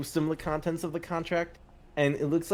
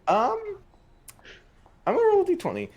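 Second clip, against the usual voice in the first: pitched way up, nervous about the roll; squeaky nervous high; 5 dB louder, faster than usual. Real, not acted. Player bracing for the dice roll, high squeaky voice.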